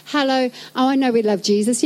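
usual speaking voice

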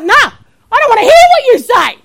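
loud, high mock defiance; giving attitude. Patient refusing to listen to the doctor, loud attitude. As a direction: shut him down with loud sass. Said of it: "giving this guy attitude".

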